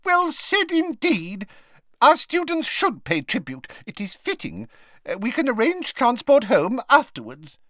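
squeaky voice. Acted. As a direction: squeak it earnestly. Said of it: "squeaked Professor Flitwick".

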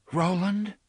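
high, worried questioning; anxious concern. A narrator's reading. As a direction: call the name with worry. Susanna anxiously addresses dazed Roland.

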